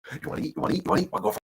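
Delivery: mock-excited owner talking to a puppy. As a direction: hype the dog up with repeated questions. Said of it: "we get 'em all jacked up".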